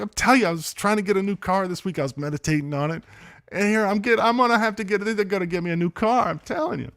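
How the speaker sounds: high, loud, gushing reenactment; retold excitement. Son telling mom he meditated for a new car, excited. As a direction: pile on insistence, acting out elation. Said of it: "I was so excited".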